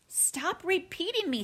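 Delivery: high, irritated big sister; fed-up sibling complaint; exasperated. A narrator's reading. Sister scolds copycat brother.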